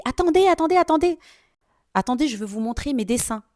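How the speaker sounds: put-on high, loud calling child. Schoolboy running after her to show drawings, high eager calling.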